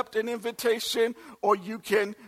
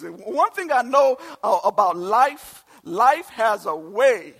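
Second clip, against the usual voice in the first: louder, rising preacher's call; preaching at full force; 2 semitones higher, 7 dB louder than usual. Acted. Pastor builds to the congregation, preaching loudly. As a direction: drive it home to the congregation.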